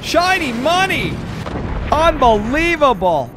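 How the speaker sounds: loud, high commentator exclamations; thrilled shouting. Real, not acted. Caster hailing Shiny Money's repeated hit, thrilled. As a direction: shout each phrase separately, stretched out in amazement.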